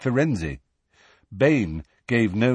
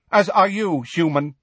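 hostile centaur; 8 semitones higher than usual. A narrator's reading. Hostile centaur figure in story.

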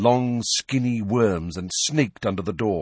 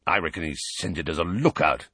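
low, gruff growl; 3 semitones lower than usual. A narrator's reading. Moody telling Tonks his theory, low gruff growl. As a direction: growl it low like a battle-worn auror. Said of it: "growled Moody".

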